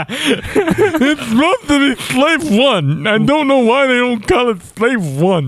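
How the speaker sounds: high, drawn-out cartoonish whine; Mad Hatter. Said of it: "you just sounded like the Mad Hatter".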